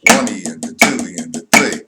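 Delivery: even, rhythmic running count on a level tune; counting sixteenth notes. Shown as a demonstration. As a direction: count the subdivisions aloud in strict time. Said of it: "say a sixteenth".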